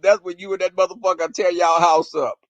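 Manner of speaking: normal talking voice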